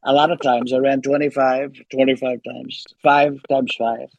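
lightly voiced boastful president impression; Donald Trump. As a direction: play a rambling, self-congratulating president. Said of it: "back to Trump".

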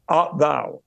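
ordinary delivery

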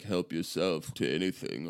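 low, soft spooky butler voice; Lurch voice. Retold or imitated. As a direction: speak low like a creepy butler. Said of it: "You're doing Lurch again".

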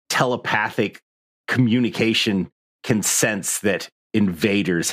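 low, slow, ominous scene setting; game master narration. A narrator's reading. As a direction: set the scene darkly and slowly. GM describes the monster scene.

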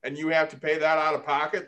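ordinary talk, nothing marked

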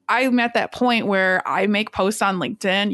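ordinary talk, nothing marked

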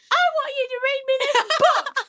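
high, loud mock Australian little boy; hammed-up Australian child demanding a story; Australian kid. Boy asking mum for a book, mock Australian accent. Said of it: "'cause he's Australian".

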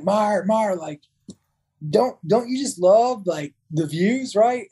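lightly imitating a dreamy, positive officer; Lieutenant Johnson, laid back. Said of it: "how laid back and positive he was".

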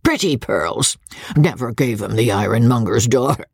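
put-on old country lord greeting guests with a cackle; coarse old baronet. Old baronet teasing his son's wife, leering. Voices old Sir Pitt.

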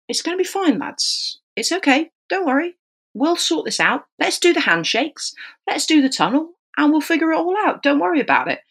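calm reassuring. Retold or imitated. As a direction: soothe them as if all is under control. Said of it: "we have to keep incredibly calm".

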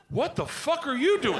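high-pitched mock scolding; exasperated wife. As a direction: imitate a wife snapping at her husband. Wife scolds him at breakfast.

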